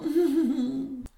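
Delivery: high wicked stepmother cackle. Acted. Stepmother gloating, high wicked cackle. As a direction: cackle with glee at the girls.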